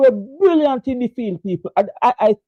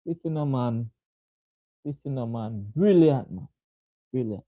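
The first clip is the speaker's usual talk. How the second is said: hushed awe; 8 semitones lower, 7 dB quieter than usual. Real, not acted. Awed at a catch.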